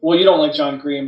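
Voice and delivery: ordinary delivery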